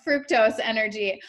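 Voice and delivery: usual speaking voice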